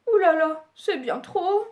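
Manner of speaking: put-on a little girl complaining; Goldilocks voice. Little girl finding Papa Bear's chair too big, mildly voiced.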